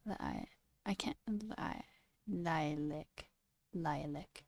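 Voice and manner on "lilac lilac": lilac very softly as "lie-lick"; quiet, careful "lie-lik" for lilac; testing own pronunciation. Shown as a demonstration. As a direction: murmur lilac to yourself, lower, ending "lick". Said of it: "That sounds like the way I'm saying it".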